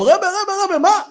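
high, pleading call; voicing a desperate disciple; urgent calling. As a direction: catch the rebbe as he passes. Yitzhak calls out to rebbe.

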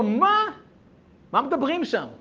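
high, loud startled question; acted astonishment. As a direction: react in disbelief, pitch jumping up. Acted startled reply to surprising order.

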